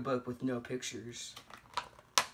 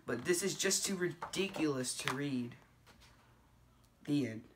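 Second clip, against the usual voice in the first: plain reading voice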